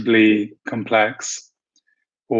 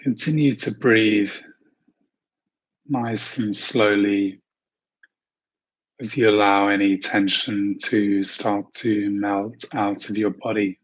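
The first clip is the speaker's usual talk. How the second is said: calm, unhurried meditation voice with long pauses; guided meditation. Acted. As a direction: ease listeners gently into relaxation, leaving space to breathe. Leads a guided visualization.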